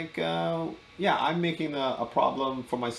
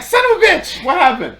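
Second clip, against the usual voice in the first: high, loud, disappointed cry; mock outrage; 11 dB louder than usual. Imitating everyone's disappointed reaction for laughs, mock outrage. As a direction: shout it in comic disbelief, pitch shooting up.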